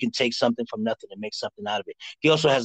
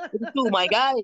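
ordinary talk, nothing marked

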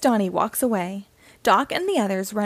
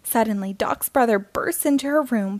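ordinary delivery

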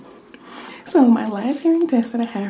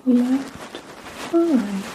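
slow soft question; 3 dB quieter, much slower than usual. Shown as a demonstration. Slow whispered test question.

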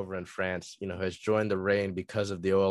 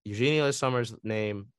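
ordinary talk, nothing marked

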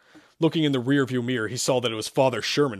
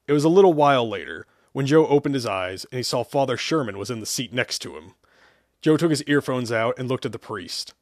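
usual speaking voice

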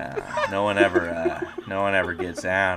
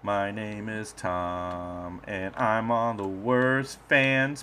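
podcast sign-off; 3 semitones higher, much slower than usual. Acted.

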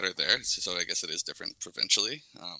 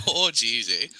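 lightly voiced hammed-up Canadian accent; thick Canadian accent. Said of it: "That was so thick".

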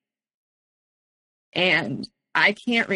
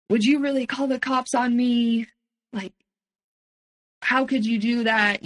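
a lightly voiced pleading boyfriend, higher; guilt-tripping plea; 4 semitones higher than usual. Abuser guilt-trips her about calling cops, lightly imitated pleading. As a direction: imitate him guilt-tripping, half-hearted. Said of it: "trying guilt trip me".

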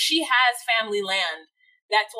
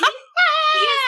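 much higher, louder breaking squeal; overwhelmed squeal; 8 dB louder, slower than usual. Real, not acted. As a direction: gush helplessly. Swooning over the character.

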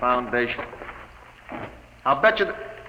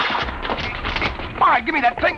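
high, loud tussle over the tube; struggling shout; 12 semitones higher, 3 dB louder, slower than usual. Acted. Sweeney wrestling the tube from the inventor, shouting high. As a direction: grab it and yell.

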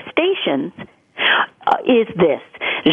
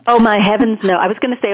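ordinary talk, nothing marked

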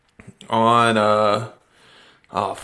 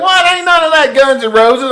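high, loud disbelieving outburst; mock-indignant DJ; 14 semitones higher, 5 dB louder than usual. DJ correcting a clueless fan, mock indignation. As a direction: object loudly, imitating an outraged radio host. Acts out DJ's indignant reply.

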